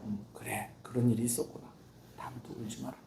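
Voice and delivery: put-on gently quiet a crying child; soothing parent. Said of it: "우는 거 달래주고".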